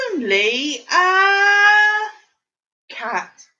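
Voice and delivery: usual speaking voice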